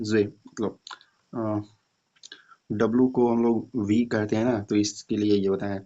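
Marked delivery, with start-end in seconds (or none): none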